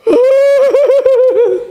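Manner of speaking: a high, startled nervous laugh. Retold or imitated. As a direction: laugh out in shock at the army. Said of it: "he's like".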